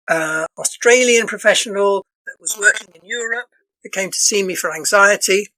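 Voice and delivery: ordinary talk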